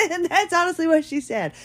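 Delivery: high-pitched, laughing through the words; amused aside, laugh still in the voice. Real, not acted. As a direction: speak while cracking up. Laughs after her own impression.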